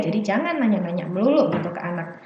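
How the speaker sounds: ordinary talk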